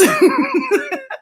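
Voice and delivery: short high laugh at her own joke; high cackle of laughter. Real, not acted.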